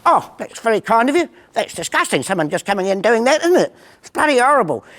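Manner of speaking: high, prissy little English bloke; little bloke's voice. Retold or imitated. Little bloke tuts to the barman, high and prissy. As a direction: sniff at the disgusting mess.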